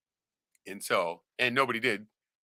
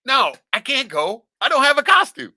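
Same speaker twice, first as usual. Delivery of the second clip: higher, louder fake excuse; whiny excuse; 11 semitones higher, 6 dB louder than usual. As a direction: wriggle out with a whine.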